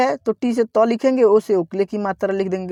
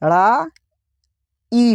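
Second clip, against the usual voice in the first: syllable-by-syllable demo; much slower than usual. Shown as a demonstration. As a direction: break लड़ाई into ल, ड़ा, ई, very slowly. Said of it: "क्या दोस्तों?".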